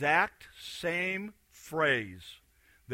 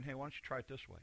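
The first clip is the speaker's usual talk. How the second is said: gentle suggestion; 13 dB quieter, faster than usual. Retold or imitated. Jesus quietly suggesting to frustrated believers, soft. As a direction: nudge them softly, no shouting. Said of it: "Not gonna stand up and scream".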